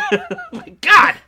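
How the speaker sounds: high, loud laughing disbelief; laughing in shock. Real, not acted. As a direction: laugh through the fright. Retelling spider scare, laughing.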